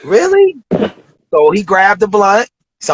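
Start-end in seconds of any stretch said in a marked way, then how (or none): 0.0-0.6 stunned disbelief. Retold or imitated. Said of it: "I'm like".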